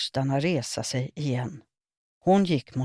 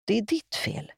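upset accusation; 7 semitones higher than usual. A narrator's reading. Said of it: "pekade upprört".

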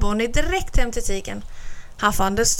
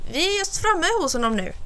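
much higher, bright little rabbit; cheerful; 8 semitones higher than usual. A narrator's reading. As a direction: tell him the good news gladly. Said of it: "sa Bonnie glatt". (glad kaninunge)

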